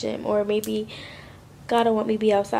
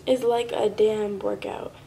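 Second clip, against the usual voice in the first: normal talking voice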